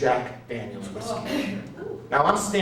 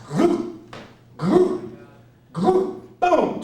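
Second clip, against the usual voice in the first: each glug higher and louder, then boom; rising glug sounds; 12 semitones higher, 4 dB louder, much slower than usual. Retold or imitated. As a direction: mimic gulping shots, climbing up. Mimicking shots downed.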